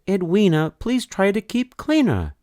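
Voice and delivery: a high, pleading nurse's voice; fussy nurse. Acted. Nurse pleading with Edwina to keep clean, high and fussy. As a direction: beg her to stay clean like a fretful nurse.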